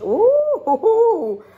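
high, rising-falling "ooh"; excited "ooh". Real, not acted. Card reader reacting to the card she just drew, high excited "ooh". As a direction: let out a loud, high-pitched whoop of "ooh".

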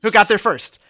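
loud teasing brag; mock boast. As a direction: play up the smug bragging.

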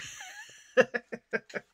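high burst of laughter at her own stumble; loud amused laugh. Real, not acted. Vlogger laughing at her tongue twister stumble, loud laugh.

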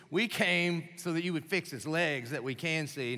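normal talking voice

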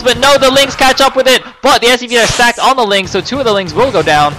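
high, loud caster hype; excited play-by-play. Real, not acted. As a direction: shout the action live.

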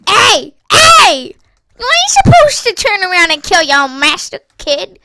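loud, scolding call-out; indignant shout. Real, not acted. Gamer to the player who turned on his master, indignant shout. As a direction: shout down a disobedient underling.

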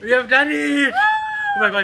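laughing cry of relief; high, loud, triumphant yell; excited shout. Real, not acted. Cheering after the ice bucket.

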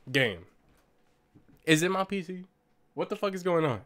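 ordinary talk

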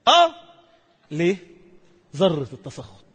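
usual speaking voice

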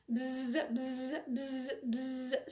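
buzzy housefly. Acted. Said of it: "said the five".

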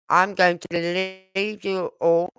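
normal talking voice

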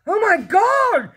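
slow, very high, loud yell. A narrator's reading. Father sees himself in the mirror, yelling. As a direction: yell it in horror. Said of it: "yelled the father".

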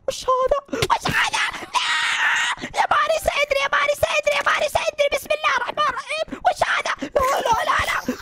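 high, wailing terrified pleas for help. Acted. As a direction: scream at the monster. Multi-headed monster appears.

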